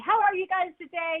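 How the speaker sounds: a touch of big-personality energy; energetic greeting. Retold or imitated. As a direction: burst out of the gate with a lively hello. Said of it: "I tend to energetically come out in whiplash".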